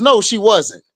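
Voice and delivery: usual speaking voice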